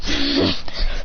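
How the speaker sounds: low, voiceless mock laugh; acted chuckle. Hero after beating the clans, low acted laugh. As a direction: put on a low breathy laugh.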